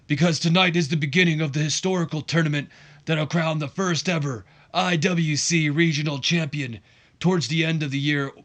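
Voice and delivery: mild imitated accent rising to a shouted question; Johnny Laurinaitis accent. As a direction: hype the crowd like a corporate wrestling boss. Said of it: "he's got a Johnny Laurinaitis, uh, accent".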